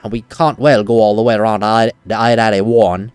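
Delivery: low, deepened mock-Australian voice; faux Australian accent. Shown as a demonstration. Player redoing the game character's line, faux Australian accent. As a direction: put on a half-British fake Australian accent. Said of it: "I can do a faux Australian accent".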